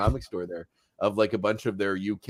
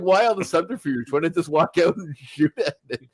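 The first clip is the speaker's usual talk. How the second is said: lightly hammed-up, raised-pitch questioning; mock theatrical; 6 semitones higher, 4 dB louder than usual. Host pokes fun at a villain's needless theatrics, mock-dramatic questioning. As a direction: question it with playful stage exaggeration.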